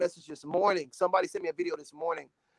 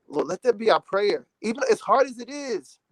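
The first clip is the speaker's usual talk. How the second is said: real emotion, pitched higher; near tears; 5 semitones higher, 4 dB louder than usual. Real, not acted. Moved by a mother singing over her baby, tearful. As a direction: pray it out, close to crying. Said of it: "I'm about to cry right now".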